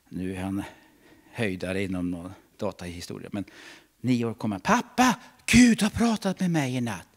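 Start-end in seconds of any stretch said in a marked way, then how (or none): 4.6-7.1 higher, lightly voiced boy; a little boy bursting with news; excited child. Preacher voicing his nine-year-old son telling dad, excited. Son's words retold.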